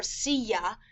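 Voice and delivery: silla with the double L said as a Y; double L demo. Shown as a demonstration. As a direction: say silla as "see-ya". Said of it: "A single L sounds different than a double L".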